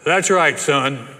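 loud, firm biker voice; big biker. Retold or imitated. Huge biker looking at a four-year-old boy, big firm voice. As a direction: answer the child with booming authority.

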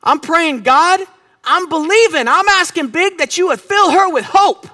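slow, emphatic prayer with stretched key words; fervent prayer. Shown as a demonstration. Preacher praying hope and joy over his wife, fervent prayer. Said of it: "Here's what I'm praying out for".

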